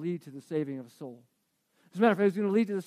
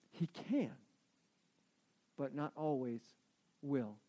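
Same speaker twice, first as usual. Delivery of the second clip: "can" pressed firmly and "will" kept light and falling; stress on can over will; 6 semitones lower, 13 dB quieter, slower than usual. Shown as a demonstration. Preacher stressing what Jesus can do, not always will. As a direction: lean on "can", let "will" drop away after a pause. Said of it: "I wanna emphasize the word can and not will".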